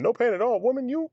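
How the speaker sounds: high, pleading no woman; panicked patient begging the nurse, high and pleading; mock panicked protest. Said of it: "She's like".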